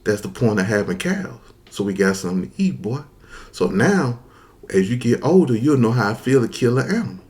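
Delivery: put-on hushed, settled grandparent talking down a child; calm elder. Grandparents explaining why they keep cows, calm.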